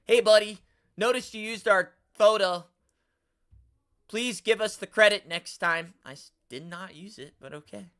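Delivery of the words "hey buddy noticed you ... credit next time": higher, louder sneering sing-song; mocking a patronizing commenter, louder and higher; mock condescending. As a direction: mimic the smug commenter. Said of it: "it comes off condescending when you say".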